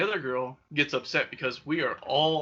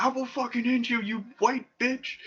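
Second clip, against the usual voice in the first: much higher put-on threatening voice; mock enraged threat; 10 semitones higher than usual. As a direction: play the furious guy getting in her face. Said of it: "He's like".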